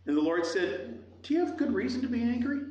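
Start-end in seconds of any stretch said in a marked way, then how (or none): 1.2-2.6 high, quick rising question; probing question. Acted. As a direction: ask it pitched up and brisk, rising at the end. God's question, first take.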